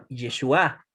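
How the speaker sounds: Yeshua said as ye-shu-A; stress on last syllable. Shown as a demonstration. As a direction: hit the final A of Yeshua. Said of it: "con acentuación en la última sílaba".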